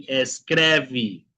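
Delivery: "escreve" ending in a vee sound; final E as I. Shown as a demonstration. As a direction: model "escreve" as es-KREH-vee. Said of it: "Siguiente palabra".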